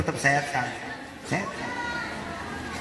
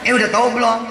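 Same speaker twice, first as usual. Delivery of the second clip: eager gossip; 5 semitones higher, much faster than usual. Retold or imitated. A gossiping neighbour baits the listener, eager and raised. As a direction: lean in keen to spill the news. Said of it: "Jangan nanya".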